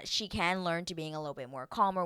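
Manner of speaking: normal talking voice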